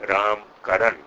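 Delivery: "Ram Karan" with both final a vowels dropped; loud, deliberate "Ram Karan", ending on m and n; English spelling pronunciation. Shown as a demonstration. Lecturer giving own name as written, English spelling pronunciation. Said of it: "as it is written in English".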